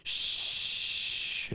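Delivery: drawn-out hiss of a crowd's shush; long shushing. Retold or imitated. Teachers answering the waterfall cue, long shush.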